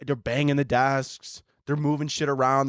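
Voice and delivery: ordinary talk, nothing marked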